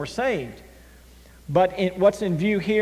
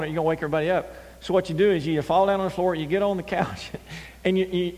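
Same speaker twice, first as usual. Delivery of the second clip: usual speaking voice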